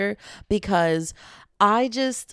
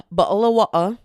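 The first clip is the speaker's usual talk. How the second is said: louder, hammed-up British glottal stops; bad British accent; 6 dB louder than usual. Shown as a demonstration. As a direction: have another go at British, punching it out. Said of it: "best thing I can say".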